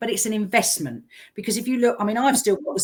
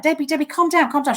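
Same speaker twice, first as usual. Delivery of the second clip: raised, urgent soothing; calming her down; 8 semitones higher, faster than usual. Retold or imitated. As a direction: talk her down from panic. Calming a panicked friend.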